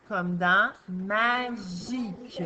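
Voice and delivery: magique said slowly, each syllable drawn out; slow model word. Shown as a demonstration. Teacher modelling the word magique slowly. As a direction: stretch out magique for the class. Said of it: "Ça va faire que".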